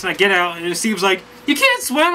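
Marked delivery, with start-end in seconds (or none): 1.5-2.1 much higher, incredulous question; surprised friend. Retold or imitated. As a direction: imitate the friend's disbelief. Steve surprised at the news.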